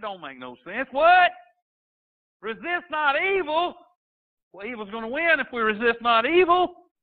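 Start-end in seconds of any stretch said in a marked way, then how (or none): 0.9-3.7 incredulous what. Acted. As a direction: throw out a stunned, mocking what?. Preacher voices disbelief at Jesus.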